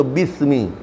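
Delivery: "bismi" with th in place of s; child's lisped mispronunciation. Retold or imitated. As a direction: imitate the error, "bismi" as "bithmi". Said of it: "belum bisa mengucapkan ruh ke bismi".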